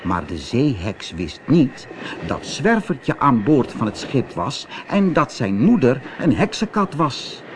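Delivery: plain reading voice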